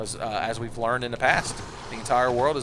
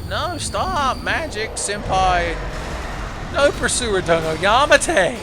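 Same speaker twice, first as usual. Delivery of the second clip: high, loud, slow anime plea; overacted anime dub; 8 semitones higher, 7 dB louder, slower than usual. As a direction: ham it up like a dubbed anime. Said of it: "mock anime pleading at boss".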